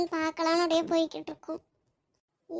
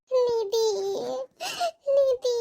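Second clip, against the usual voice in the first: high-pitched tearful cry of a friend's name; crying call, higher and pleading; 6 semitones higher, much slower than usual. Acted. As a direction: call out to your friend, breaking into sobs. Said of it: "எதுக்கு இப்படி அழுதுகிட்டு இருக்க".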